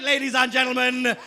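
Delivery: ordinary talk, nothing marked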